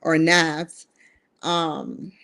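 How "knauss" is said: "Knauss" said as NASS, short a as in cat; louder guess at "Knauss", rhyming with mass; alternative pronunciation. Shown as a demonstration. Vlogger offering another guess at a surname, Knauss said as NASS. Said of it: "I don't know how to say it".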